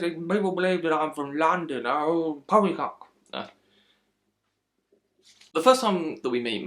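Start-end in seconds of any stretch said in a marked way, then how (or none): 0.0-3.1 put-on British accent, a touch higher; bad British accent, poppycock at the end; mock British accent. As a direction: imitate a hammed-up fake British accent. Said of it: "he speaks like this".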